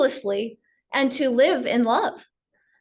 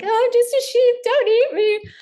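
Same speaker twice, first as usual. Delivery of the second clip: much higher, louder squeaky pleading voice; timid sheep lion; 12 semitones higher, 4 dB louder than usual. Retold or imitated. Cub pleads with the lion in a high squeaky voice. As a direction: beg like a tiny scared creature. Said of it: "the little sheep lion is like".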